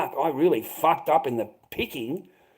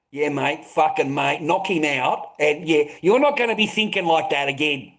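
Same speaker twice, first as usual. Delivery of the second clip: ordinary talk, nothing marked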